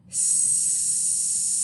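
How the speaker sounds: long hissing S with voice off; drawn-out s like a snake. Shown as a demonstration. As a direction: hold a quiet snake hiss on S. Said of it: "This is what the S snake sound sounds like".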